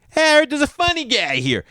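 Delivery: high, loud club-manager caricature; mock hearty holler. Club manager hailing the visiting comedian, mock hearty holler.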